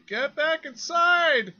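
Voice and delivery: high, loud, drawn-out yell; full-voiced shout, each word stretched; shouted order. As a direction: shout it down the street as a command. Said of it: "someone shouts at you".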